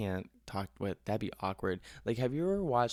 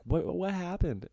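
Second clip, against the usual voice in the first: worried mom imitation; 9 semitones higher, 3 dB louder, slower than usual. Mom asking in the story.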